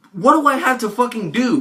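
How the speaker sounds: loud, frustrated demand; angry outburst. Real, not acted. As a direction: shout it out in frustration. Angry plea to friends.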